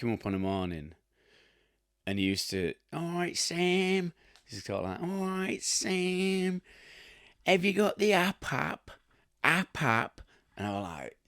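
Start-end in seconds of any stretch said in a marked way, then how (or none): 2.9-4.1 drawn-out, high-pitched Cockney imitation; mock Cockney. Workmate greeting Sam each morning, mock Cockney. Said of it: "your Cockney people". 5.0-10.2 drawn-out, high-pitched Cockney imitation; mock Cockney. London workmate pestering the plasterer, mock Cockney. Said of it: "your Cockney people".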